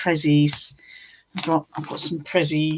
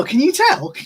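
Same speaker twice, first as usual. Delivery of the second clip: excited; 10 semitones higher, 3 dB louder than usual. Real, not acted. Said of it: "I'm excited as well".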